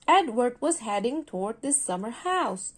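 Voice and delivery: plain reading voice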